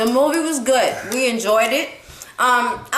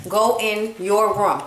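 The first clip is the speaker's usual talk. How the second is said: drawn-out command, each word spaced; slow, firm parental order; 3 semitones lower, slower than usual. Real, not acted. Parent repeating an order to child, slow and firm.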